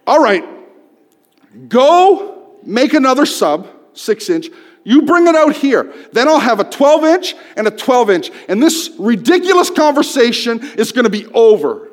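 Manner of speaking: loud, high, exasperated shouting; angry yell. Retold or imitated. Customer shouting his fix at the elderly clerk. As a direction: push every word out, losing patience.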